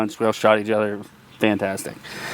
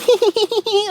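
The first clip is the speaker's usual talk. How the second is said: put-on high squeaky little-kid taunt; mock child cackling hee hee; kid's teasing giggle. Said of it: "like that, like little kids do".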